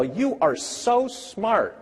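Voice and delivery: put-on high, slow, sing-song praise for a small child; sweet praise. Teacher praising a six-year-old, high and slow sing-song. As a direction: gush over the kid like a proud teacher.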